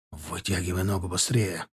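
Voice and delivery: low, hushed urgent order; under his breath. A narrator's reading. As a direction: hiss it quietly but urgently. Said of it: "вполголоса бросил я".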